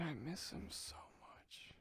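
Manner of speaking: quiet, slow breaking whisper; tearful whisper. Real, not acted. Missing grandfather, slow tearful whisper. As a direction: let the grief catch the voice. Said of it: "But, man".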